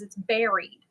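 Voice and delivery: "buried" said BERRY-d, rhyming with "berry"; daughter's correction. Retold or imitated. Daughter correcting parent's word, "buried" as BERRY-d. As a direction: punch "buried" with an "eh" vowel, like berry. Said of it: "I say it wrong".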